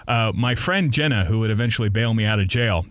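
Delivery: ordinary talk, nothing marked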